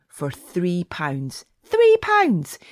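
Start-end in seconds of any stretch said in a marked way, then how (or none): 1.6-2.6 excited. Acted. Ad read exclaiming the price.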